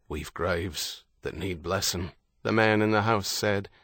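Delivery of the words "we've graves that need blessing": softer, slow grieving man; lower voice. Acted. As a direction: drop the voice, slow and solemn. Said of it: "his voice lower".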